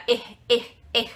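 "er" ending in a breathy H; aspirated S. Shown as a demonstration. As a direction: isolate the "er", its R an exhaled H. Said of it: "como se fosse um R e não um S".

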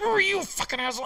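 high-pitched mimicked tirade; imitated angry yelling. Worker mimics the foreman's outburst, high angry yell. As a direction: curse him out like the mad foreman. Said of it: "he, like, got mad".

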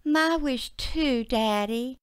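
bright little daughter answering; young girl voice. Acted.

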